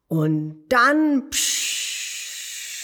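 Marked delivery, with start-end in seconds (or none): 1.3-2.8 slow fire whoosh. Acted. Dragon breathes out fire.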